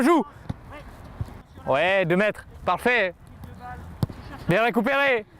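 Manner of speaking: high-pitched calls to players across the pitch; coaching call-out. Real, not acted. Coach calls to players during training. (consignes d'entraîneur lancées d'une voix aiguë)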